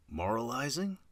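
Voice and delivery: "Moralizing" rising high as a question; tentative guess. A narrator's reading. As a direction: offer "Moralizing" as a guess, pitch climbing steeply. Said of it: "Avon tried".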